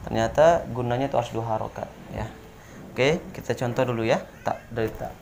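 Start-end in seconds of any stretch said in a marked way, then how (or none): none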